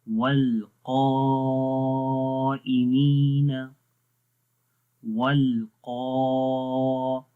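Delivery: والقائمین chanted very slowly, قا thick and full; slow melodic tajweed of والقائمین; heavy qaf recitation. Shown as a demonstration. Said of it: "تو اس کو بھی موٹا پڑھتے ہیں".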